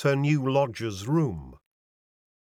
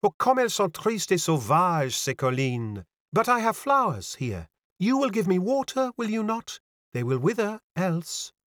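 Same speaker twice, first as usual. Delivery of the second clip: put-on lilting, higher French-accented girl; French young lady, pitched up; mincing French accent; 7 semitones higher than usual. A narrator's reading. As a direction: declare it airily with a French accent. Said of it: "with a touch of the mincing French accent".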